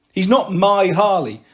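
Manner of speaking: plain reading voice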